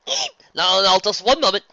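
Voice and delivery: loud, high, urgent objection; flustered protest. Acted. Luna stopping the attendants, flustered. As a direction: cry out to halt them.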